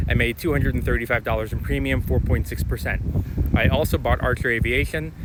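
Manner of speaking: usual speaking voice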